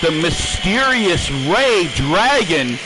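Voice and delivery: normal talking voice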